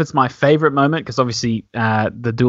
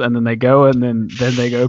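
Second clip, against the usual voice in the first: ordinary delivery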